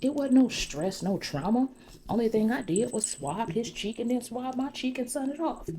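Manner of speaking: high, loud retold defense; indignant protest. Arby defending the cheek swab to Shayla, indignant retelling. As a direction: act out his exasperated denial.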